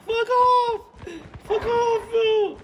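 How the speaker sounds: very high silly squeak; mock it in a tiny high voice; squeaky mock voice. Repeats in put-on voice.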